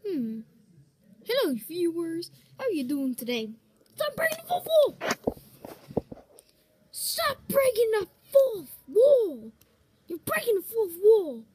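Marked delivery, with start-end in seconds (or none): 4.0-11.4 high, loud, outraged yell; frantic yelling. Acted. Character screaming at the host for breaking the fourth wall. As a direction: scold him at full voice.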